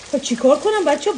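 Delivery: ordinary talk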